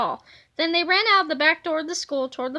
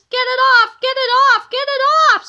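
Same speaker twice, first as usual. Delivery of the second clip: very high, loud screaming, getting higher; panicked scream; 9 semitones higher, 10 dB louder, faster than usual. A narrator's reading. Finkstein screams while swatting the foam off his legs, frantic shriek. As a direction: shriek it while jumping about. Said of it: "screamed Finkstein".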